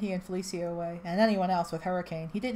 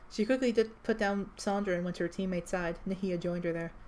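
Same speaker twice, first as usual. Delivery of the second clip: ordinary delivery, nothing marked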